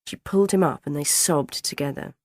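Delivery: ordinary delivery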